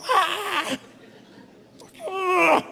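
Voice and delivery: high-pitched drawn-out "ah" yell; comic high scream of frustration; road-rage scream. Retold or imitated. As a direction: let out a sudden high holler. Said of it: "I go".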